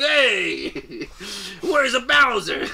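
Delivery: loud, high, drawn-out mock-Italian yell; angry Italian. Mario hunting for his enemy, hammed-up angry Italian. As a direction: ham up an irate Italian plumber. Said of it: "Just an angry Italian".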